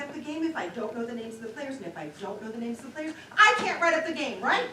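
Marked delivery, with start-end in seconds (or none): 3.4-4.6 louder, higher exasperation; finally bursting out; frustrated. Acted. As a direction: reporter losing patience, loud. Reporter frustrated by the confusion.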